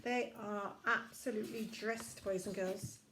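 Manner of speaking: ordinary delivery, nothing marked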